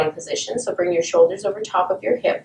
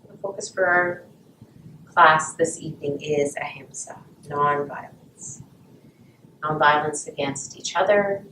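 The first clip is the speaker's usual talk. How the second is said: guided meditation; 3 semitones lower than usual. Acted. Guided breathing at class start.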